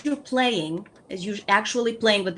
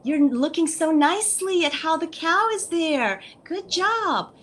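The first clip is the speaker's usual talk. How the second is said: much higher, bright praise voice; praising a child; 9 semitones higher, 4 dB louder than usual. Shown as a demonstration. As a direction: cheer the toddler on in a high voice. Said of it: "give them that praise".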